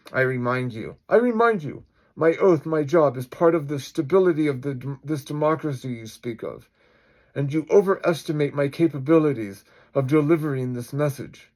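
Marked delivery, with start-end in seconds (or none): 1.1-1.8 high, loud, indignant insistence; emphatic high-pitched warning; insistent police chief. Acted. Chief's line, redone higher and louder. 2.2-11.5 forceful, emphatic official; lightly voiced indignant chief; insistent police chief.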